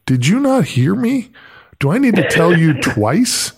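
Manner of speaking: high, mock-irritated coach voice, breaking into laughter; hammed-up irritated boss. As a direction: play the annoyed coach, laughing through it. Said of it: "he looked at me so irritated".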